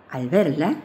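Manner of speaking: plain reading voice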